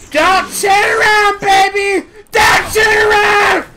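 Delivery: high, full-voice yelling of the phrase; loud shouting. Real, not acted. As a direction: belt it out like a chant. Repeats line quiet then loud.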